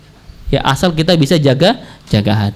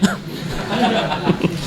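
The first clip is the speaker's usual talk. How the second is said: short laugh; 10 dB quieter than usual. Real, not acted. Preacher jokes about temptation.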